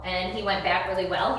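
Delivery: ordinary talk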